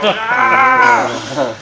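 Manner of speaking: high, loud drawn-out mock scream; mocking yell. Training partner making fun of his rival's yell, mocking scream. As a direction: imitate a yell to ridicule it. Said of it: "making fun of how I yell".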